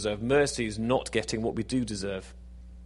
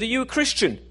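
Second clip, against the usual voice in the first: urgent woman; 11 semitones higher, 5 dB louder than usual. Acted. Woman urgently questions suicidal man.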